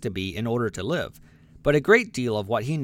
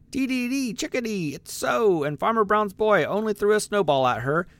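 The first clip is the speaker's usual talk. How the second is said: much higher, chirpy little bird voice, faster at the end; chickadee voice; 10 semitones higher, 4 dB louder than usual. A narrator's reading. Tommy Tit the Chickadee backs up the jay, in a much higher chirpy bird voice. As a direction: sing it out like a tiny chickadee. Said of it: "declared a new voice".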